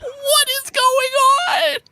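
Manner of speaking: high, slow, laughing bewilderment; baffled. Retold or imitated. Gamer baffled by a stranger staring at the corner, slow high bewilderment. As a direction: stretch it out in amused disbelief.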